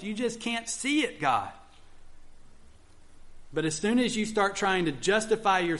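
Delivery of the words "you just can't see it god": higher, insistent pleading to God; pleading Jonah. Retold or imitated. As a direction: plead your case like Jonah defending himself. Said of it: "God to know that there's good reasons".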